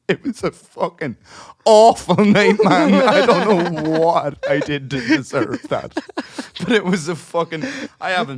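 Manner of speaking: high, loud words through real laughter; laughing despair. Real, not acted. Looking back on an awful gig, real helpless laughing wail. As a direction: wail it while cracking up, words stretched.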